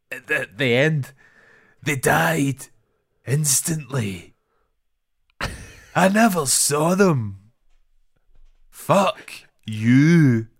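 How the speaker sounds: slow, loud, mock-dramatic film delivery; overdramatic acting. Mimicking the film's actors to a co-host, overdramatic. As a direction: draw each word out as if trying far too hard. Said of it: "trying so hard to be dramatic".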